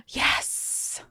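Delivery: hushed, breathy yes; thrilled whisper after a gasp; whispered excitement. Real, not acted. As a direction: whisper it in delight. Thrilled by the plush update.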